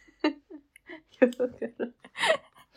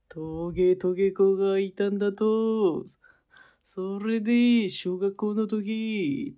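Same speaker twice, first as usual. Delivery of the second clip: Anago-san impression; 3 semitones higher, 4 dB louder, slower than usual. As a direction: talk slow and heavy like Anago. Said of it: "アナゴさんのモノマネで、".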